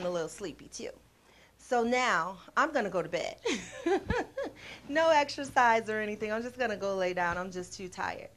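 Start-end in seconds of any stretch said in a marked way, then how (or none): 3.4-4.8 short amused laugh; a quick giggle; light laugh. Real, not acted. Laughs at going to bed.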